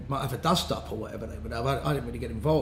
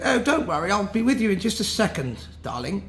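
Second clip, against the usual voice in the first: put-on posh English, pitched higher; 5 semitones higher than usual. Hooligan in kilt reassures the girl, put-on posh voice. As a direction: imitate a hooligan playing posh. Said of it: "he put on a posh voice".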